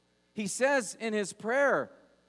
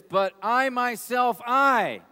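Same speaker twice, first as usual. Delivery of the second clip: slow, louder and higher on myself and I; "I" stretched, loud and high; emphatic; 5 semitones higher, 8 dB louder, slower than usual. Shown as a demonstration. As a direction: hammer home "But I myself, I". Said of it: "it's emphatic".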